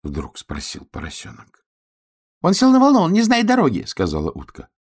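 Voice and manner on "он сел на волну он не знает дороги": little duck voice. Acted.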